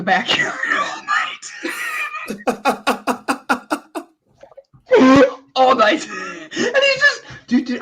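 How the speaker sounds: breathy laughter, pitched high, words half-whispered; can barely get it out for laughing; laughing while telling. Real, not acted. Laughing while retelling dad story.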